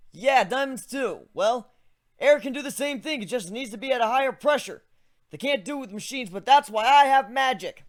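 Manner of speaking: put-on a young griffin walking a friend through his magic; griffin hero voice. Griffin explains his spell.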